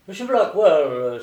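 loud, drawn-out exclamation; crying out. A narrator's reading. Lord bursting in on his stockbroker, crying out. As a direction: hail the broker loudly and slowly. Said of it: "s'écria le noble lord".

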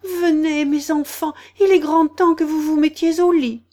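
high, loud anxious summons; canary nervously calling its little ones; trembling voice. A narrator's reading. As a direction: hurry the children off in a high, trembling cry. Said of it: "d'une voix tremblante".

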